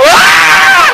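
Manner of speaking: very high, loud shout; high, loud yelp of a pushed commuter; mock startled yelp. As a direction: yelp as if shoved into the train. Mimics being pushed into train.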